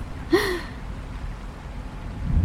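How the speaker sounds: high airy laugh; light laugh. Acted. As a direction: laugh off the warning. Dora laughing.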